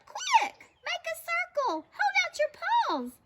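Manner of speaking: very high, urgent commands; rally everyone with squeaky shouts; yelled orders. A narrator's reading. Pip organises a catch, urgent yelling. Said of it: "yelled Pip".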